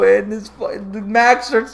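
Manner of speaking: ordinary talk, nothing marked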